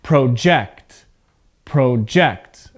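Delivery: careful repeated verb Project, pro-JECT; stress on second syllable. Shown as a demonstration. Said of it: "I want you to hear my pronunciation again".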